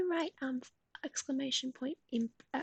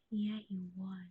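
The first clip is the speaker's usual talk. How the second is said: ordinary talk, nothing marked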